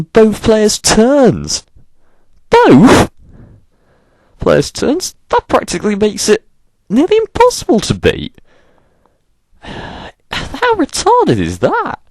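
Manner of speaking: high, exasperated, incredulous; voice shooting up. Real, not acted. Ranting to viewers about the card rule, voice shooting up high. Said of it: "my voice is changing an awful lot".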